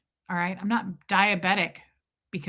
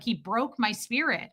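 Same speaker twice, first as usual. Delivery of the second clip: ordinary delivery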